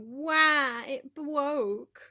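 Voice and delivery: moaning to viewers about broken tech, mock whine. Self-mocking to chat about her computer, mock whine. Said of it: "I can go".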